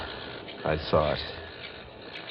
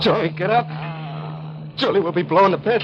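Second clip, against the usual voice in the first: higher, louder frantic plea; 9 semitones higher, 3 dB louder than usual. Acted. Boy urging the dazed girl before the blast, frantic. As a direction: urge her up in panic.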